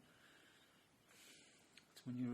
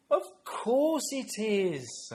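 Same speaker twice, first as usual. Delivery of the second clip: high, slow, loud exaggerated agreement; stretched-out mock realisation; humouring a child; 10 semitones higher, 11 dB louder, much slower than usual. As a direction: play along grandly with a toddler. Grandad humouring granddaughter.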